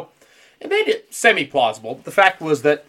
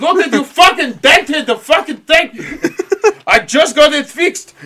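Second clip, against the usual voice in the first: high, loud, hammed-up Russian complaint; badly faked Russian accent, cracking up; terrible Russian accent; 10 semitones higher, 8 dB louder than usual. Captain whose sub got dented, ranting in bad Russian accent. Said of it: "That's a terrible Russian accent".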